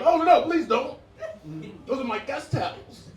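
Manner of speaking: high-pitched fussy hostess; mock horrified protest. Hostess stops guest using her towels, high mock-horrified protest. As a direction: plead to protect your precious things.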